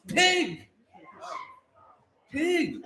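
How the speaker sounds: very high-pitched, short cry; squealed answer. Real, not acted. Host reveals the animal answer, high squeal. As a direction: squeal it like a pig.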